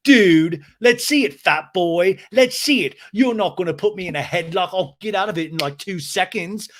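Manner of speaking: loud, high mock challenge; James's taunting dare. Recapper imitating James daring Schwartz to try a headlock, loudly combative. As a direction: goad him like someone spoiling for a fight.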